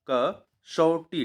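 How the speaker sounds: कसौटी drawn out slowly, stressing the retroflex टी; slow spelling out. Shown as a demonstration. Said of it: "top, A".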